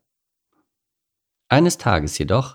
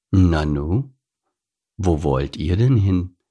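slow, lightly voiced smooth stranger; a hint of a smooth-talking big baboon; unhurried, mildly surprised question; mellifluous baboon; slower than usual. A narrator's reading. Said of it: "eine wohlklingende Stimme".

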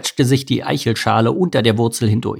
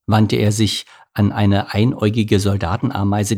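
plain reading voice

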